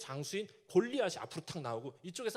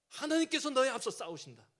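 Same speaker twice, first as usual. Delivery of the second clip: 하나님께서 stressed, louder, higher, falling; rousing command; 5 semitones higher, 6 dB louder than usual. Retold or imitated. Deborah urging the anxious Barak into battle, loud rousing command. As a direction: drive home 하나님께서 with full force. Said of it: "힘주는 표현을 하는데요".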